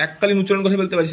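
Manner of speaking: usual speaking voice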